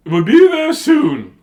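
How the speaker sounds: high, loud, slow sing-out; calling out. Acted. Teacher reassuring kids on the bus, calling out.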